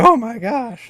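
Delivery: loud, high excited yelp; excited shout. Retold or imitated. Caster recalling his reaction to a triple headshot, excited shout. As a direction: yell it like a stunned fan watching a triple headshot. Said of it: "I was screaming to myself".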